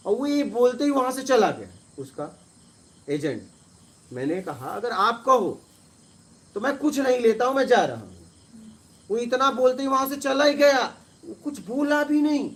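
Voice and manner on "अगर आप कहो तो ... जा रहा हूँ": retold angry retort.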